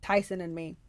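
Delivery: ordinary delivery, nothing marked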